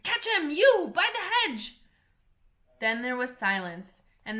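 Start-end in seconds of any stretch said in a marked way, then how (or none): 0.0-1.8 high, loud scream; rabbit screaming. A narrator's reading. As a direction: yell across the garden. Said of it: "The rabbit's voice screamed".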